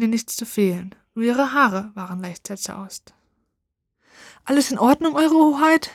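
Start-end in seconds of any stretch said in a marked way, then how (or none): 4.4-5.9 high, louder call across the ruin; boy calling to the princess, raised and high. A narrator's reading. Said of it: "rief der Knabe".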